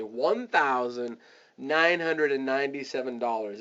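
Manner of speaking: "one thousand nine hundred and ninety-seven dollars" said in measured chunks; spelled out fully. Shown as a demonstration. Coach showing the right way to quote price, deliberately spelled out. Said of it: "you wanna say".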